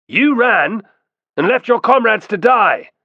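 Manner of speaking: high, loud accusation; accusing cry. A narrator's reading. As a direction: shout him down. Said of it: "cried a third".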